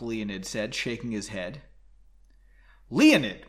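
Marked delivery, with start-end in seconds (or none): 2.9-3.5 a loud, sharp snap of his name. Acted. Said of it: "Margot snapped".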